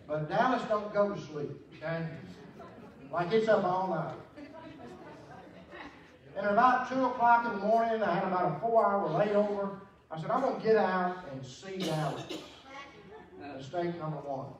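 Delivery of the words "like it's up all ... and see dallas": full force preaching. Acted. Preacher telling the congregation the Bible story, in full cry. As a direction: lean into the gospel story.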